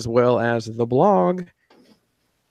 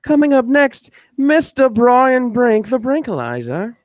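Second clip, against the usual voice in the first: high, loud, very slow announcer call; announcer intro; 8 semitones higher, 3 dB louder, slower than usual. Acted. Host introducing Brian Brink, announcer style. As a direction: stretch the name like a ring announcer.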